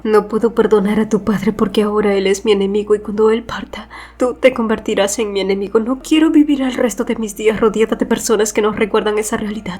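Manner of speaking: mildly pained reproach in her voice; hurt reproach. A narrator's reading. Rieta arguing with Killian after the kiss, mildly hurt reproach. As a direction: reproach him with a touch of hurt. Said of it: "el único reproche que le dictaba su corazón dolido".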